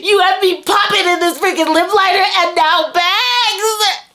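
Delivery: high, loud, overjoyed shout of thanks; high excited gushing; overwhelmed squeal. Real, not acted. Emotional gift reaction, overwhelmed.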